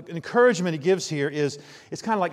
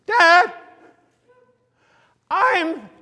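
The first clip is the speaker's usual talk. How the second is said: put-on much higher and louder, a piping child's voice; squeaky kid; 12 semitones higher, 9 dB louder, much slower than usual. Son blurting his age at the ticket booth, squeaky high. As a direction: squeak it out like an eager little son. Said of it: "I hear this squeaky noise next to me".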